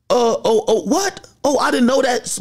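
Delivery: high mock gasp of surprise, lightly done; a touch of faked shock. Imitating her sudden surprise.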